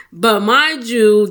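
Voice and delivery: usual speaking voice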